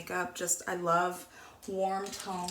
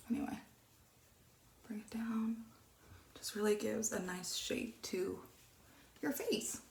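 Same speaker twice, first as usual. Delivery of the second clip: whisper; 6 dB quieter than usual. Real, not acted. Quiet makeup commentary.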